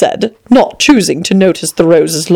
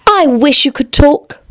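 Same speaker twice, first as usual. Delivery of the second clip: put-on young girl voice; 8 semitones higher, slower than usual. Alice speaks to the flower.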